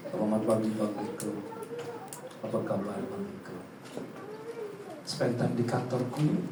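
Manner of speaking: low, soft, formal executive voice; measured, hushed official politeness; self-important commissioner. Acted. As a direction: speak like a newly promoted big shot. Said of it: "apakah dengan gaya gitu dia jadi sombong".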